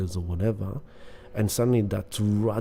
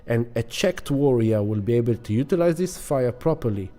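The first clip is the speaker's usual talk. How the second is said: ordinary talk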